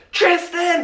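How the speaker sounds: dad calling out his son in anger; dad's yell. Retold or imitated. Dad yelling for his son after the pee prank, sharp high shout. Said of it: "just yelled at me".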